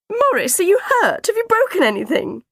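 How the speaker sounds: very high, loud anxious questions; frantic motherly alarm; panicked fussing. A narrator's reading. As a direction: fuss over an injured child at full pitch. Mother panicking over fallen son.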